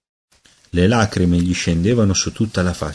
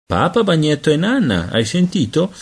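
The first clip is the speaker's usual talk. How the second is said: put-on higher sing-song baby talk; coaxing aunt; 7 semitones higher than usual. A narrator's reading. Aunt Laura cooing the routine to the baby, higher voice. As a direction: coo at the baby.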